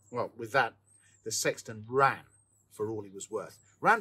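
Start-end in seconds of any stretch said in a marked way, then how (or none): none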